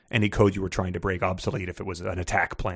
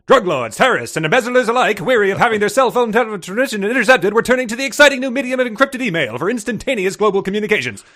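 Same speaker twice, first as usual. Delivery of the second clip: high, loud newsreel announcer read; old-timey newsreel voice; 11 semitones higher, 6 dB louder than usual. Shown as a demonstration. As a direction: boom it out like a 1940s newsreel narrator. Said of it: "in an old-timey newsreel voice".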